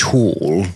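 "tall" said as posh British "tawl"; mock posh British "tall"; posh British vowel. Shown as a demonstration. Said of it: "We have to say things like".

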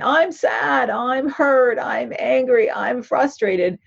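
lightly voiced whiny child; emotional brain voice. Shown as a demonstration. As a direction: act out the upset inner child, high and wounded. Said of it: "which is the emotional brain".

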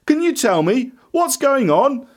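calling out. A narrator's reading. Blind beggar asking the passing crowd, calling out. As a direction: call out for someone's attention. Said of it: "he called out".

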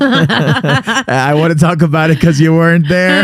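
giggly high teasing; laughing teasing. Real, not acted. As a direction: laugh while you gloat.

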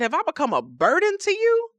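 high, loud exasperated outburst at God; a fed-up man yelling at the sky; exasperated Job. Acted. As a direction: throw it up at heaven, fed up. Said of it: "is angry".

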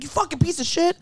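mock road rage. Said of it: "when I have road rage".

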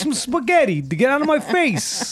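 hammed-up high New York Italian voice; New Yorker. Host keeps joking in a mock high New York accent. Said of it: "New Yorker".

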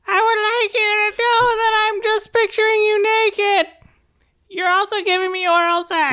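squeaky very high helium voice; cartoon chipmunk squeak. Shown as a demonstration. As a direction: talk as if you sucked helium, way up high. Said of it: "That was better".

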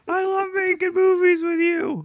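high goofy voice. Acted. Frank voice continues.

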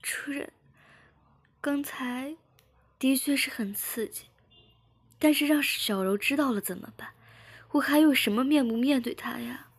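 worried sobbing plea to her master; tearful pleading. A narrator's reading. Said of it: "志敏哭着说". (带哭腔的焦急哀求)

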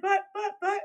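high, whiny stammered buts; meek protest. Retold or imitated. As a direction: play the scolded kid pleading. Imitating other soul protesting.

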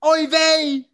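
loud high Yiddish oy vey; yiddish accent. Acted. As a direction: groan it like a Yiddish grandma. Yiddish-flavored jabs at Phil.

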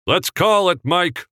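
loud high call; calling it a day. Acted. Director telling Mike to end the day, loud and high. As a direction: wrap it up with a shout.

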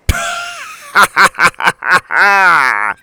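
high, loud roaring cackle; pirate captain mocking the children; fitful laughter. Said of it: "burst into fitful laughter".

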